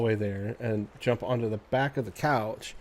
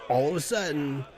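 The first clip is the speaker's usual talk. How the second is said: dramatic; 4 semitones higher than usual. Real, not acted. Dramatic build in story.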